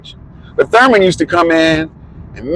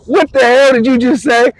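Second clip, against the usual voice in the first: incredulous; 11 semitones higher than usual. Retold or imitated. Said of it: "I was like".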